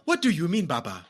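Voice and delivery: mock indignant question in a stock African accent; hammed-up movie African accent; generic African accent.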